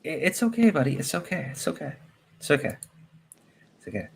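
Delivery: put-on high, soft baby-talk soothing; soothing a scared dog. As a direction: coo gently like calming a pet.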